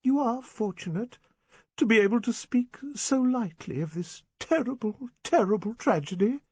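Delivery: high, pleading spinster voice, slowing at the end; a wounded old spinster reproaching him; Miss Prentice. Acted.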